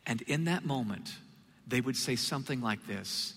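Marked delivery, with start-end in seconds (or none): none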